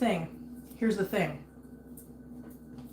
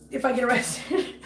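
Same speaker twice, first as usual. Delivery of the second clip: laughing; 6 dB quieter than usual. Real, not acted. Laughing about getting arrested.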